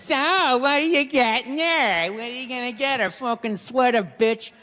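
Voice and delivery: mock nosy friends, drawn-out questions; prying girlfriends. Her friends asking about the birthday gift, mock prying. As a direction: imitate her friends fishing for gossip. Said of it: "All her friends".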